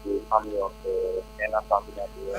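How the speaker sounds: muffled caller away from phone; distant, faint phone line; over the phone. Real, not acted. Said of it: "Speak into your phone so we can hear you".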